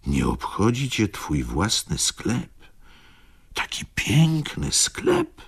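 high, incredulous questioning; astonished. A narrator's reading. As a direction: ask in disbelief, rising very high and slowing. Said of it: "zdziwił się Ignacy". (zdziwione, wysokie, niedowierzające pytanie)

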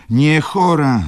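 indignant loud denial; calling out. A narrator's reading. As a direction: cry out "Nie chora" sharply. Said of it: "zawołała baronowa".

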